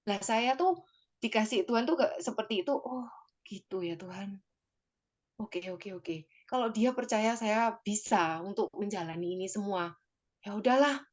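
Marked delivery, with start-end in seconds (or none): 2.8-6.3 soft, giving-in murmur to God; quiet resigned acceptance. Retold or imitated. Talking softly to God, accepting the answer, resigned and quiet. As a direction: let it drop, quietly accepting.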